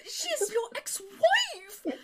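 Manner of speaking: high, slow, drawn-out protest; hammed-up high voice stretching the words; flustered lady. Lady flustered underneath as she protests, high slow voice. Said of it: "she's beginning to get flustered".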